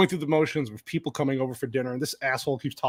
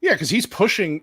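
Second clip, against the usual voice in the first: ordinary talk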